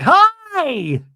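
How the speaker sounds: high, loud squealed greeting; mock a lovestruck woman on FaceTime; imitating gushing girlfriend. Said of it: "Alexis is like".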